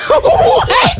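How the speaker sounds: high, laughing "what"; laughing disbelief. Retold or imitated. Hushed by the man at the bar, laughing disbelief. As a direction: squeak it out in amused disbelief.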